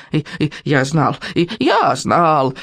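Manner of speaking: slow, stammering squeak; squeaking little dragon. A narrator's reading. Dragon insists he knew it too, squeaking and stammering. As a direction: pipe it up, stretching the repeat. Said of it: "А Беззубик пропищал".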